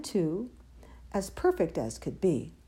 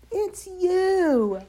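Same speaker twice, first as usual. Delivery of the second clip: high, loud, gleeful hoot; amused reveal; 10 semitones higher, 5 dB louder than usual. A narrator's reading. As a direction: sing out the happy answer. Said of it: "And they laughed and they said".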